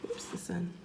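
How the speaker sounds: soft unvoiced aside; whisper. Real, not acted. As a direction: whisper a quick oops.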